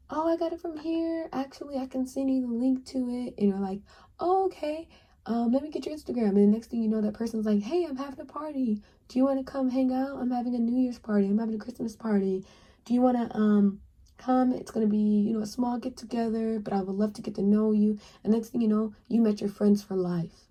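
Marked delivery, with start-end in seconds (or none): 0.1-3.3 high, bright pretend answer; hint of a pleased classmate; mock delighted reply. Voicing the other person's reply. 4.2-6.3 high and fast, pretend excited; mock eager answer. Acting asking for Instagram. 7.6-18.0 higher, upbeat pretend host; lightly voiced new friend inviting; mock friendly invitation. Voicing a party invitation.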